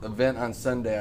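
normal talking voice

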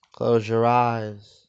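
normal talking voice

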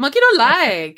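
high, playful mock monkey protest; silly high catchphrase voice. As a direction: say it like an indignant monkey, hammed up. Repeats the new monkey catchphrase.

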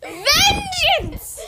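very loud, high playful yell; hammed-up war cry, half laughing; mock battle cry. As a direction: yell it like a pretend avenger. Mock battle cry during horseplay.

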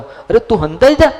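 high, loud exasperated cry with a laugh; an old man losing patience, half laughing; grandfather's loud laughing insistence to the grandson; insistent grandfather. Retold or imitated.

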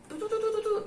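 high, soft staccato imitation; rapid hauling noise. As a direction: patter softly for item after item leaving.

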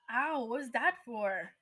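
slow, loud startled ow; pained yelp. Acted. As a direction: yelp as if just slapped. Ron slapped by Hermione.

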